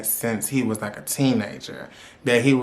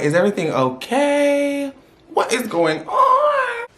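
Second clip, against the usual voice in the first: very high, stretched-out squeak; mock-alarmed high-pitched question; 13 semitones higher, 3 dB louder, slower than usual. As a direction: squeal the question, dragging out the end. Retelling anxious question to him.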